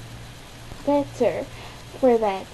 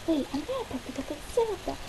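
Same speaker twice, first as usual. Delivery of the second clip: ordinary talk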